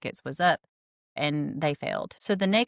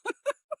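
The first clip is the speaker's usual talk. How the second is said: high giggle; 4 dB quieter than usual. Real, not acted. Laughing at the quitter.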